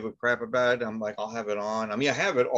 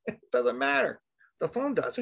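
high exasperated retort; 13 semitones higher than usual. Retold or imitated. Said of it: "And I'm like".

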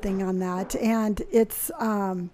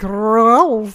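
mock French bear; 2 semitones lower, 6 dB louder than usual. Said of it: "You're like".

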